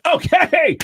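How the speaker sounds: loud, high mock stage shout; quick, booming club-host introduction; MC announcer. MC making a joke to the crowd, loud announcing voice. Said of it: "The M C came up on stage and said".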